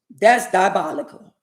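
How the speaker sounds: ordinary talk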